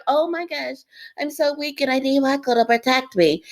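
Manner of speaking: high, whiny mock of a helpless girl; mocking Willow. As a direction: play Willow as feeble and needy, with scorn. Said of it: "I cannot stand the Willow character".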